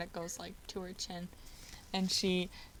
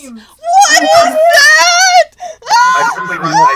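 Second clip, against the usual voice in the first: high, loud shrieking woman; piercing scream, then a wail; terrified scream; 19 dB louder, much slower than usual. Acted. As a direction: shriek in horror at the creature. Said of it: "And the woman screams".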